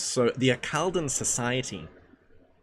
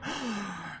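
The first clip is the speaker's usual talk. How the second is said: high, soft gasp of horror; mock gasp; 9 semitones higher, 8 dB quieter than usual. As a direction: suck in a shocked breath. Mock shock at gooning claim.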